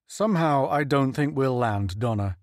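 plain reading voice

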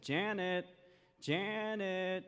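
put-on calling a child from the kitchen, high. Mother calling her daughter from the kitchen, calling out. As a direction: sing out the name to call her. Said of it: "She said".

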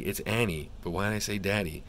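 ordinary talk

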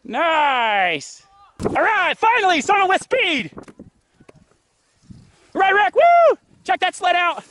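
ordinary delivery

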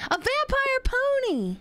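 high delighted squeal; excited squeal. Real, not acted. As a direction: squeal it out with glee. Excited discovery shout.

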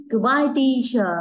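very slow, clear goodbye; slow teacher demo; slow model farewell. Shown as a demonstration. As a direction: draw it out for the kids. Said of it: "chúng mình sẽ nói nào nhỉ".

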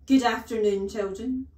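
very loud, stern headmistress bark; loud, commanding call to the pupils; barked greeting. A narrator's reading. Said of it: "she barked".

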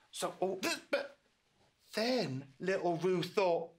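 plain reading voice

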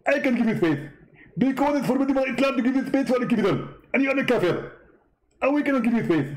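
high-pitched mock debater voice; a squeaky, smug rival preacher; Zakir Naik impression. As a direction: push the voice way up and lampoon him. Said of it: "Okay, Zakir".

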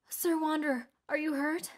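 high-pitched, worried villager voice; timid, high voice of concern. A narrator's reading. Villager worriedly approaches the weakened hero.

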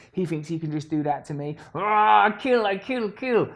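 high, loud pretend fury; mock rage. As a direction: ham up a wounded ego ready to kill. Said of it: "number one thing affected".

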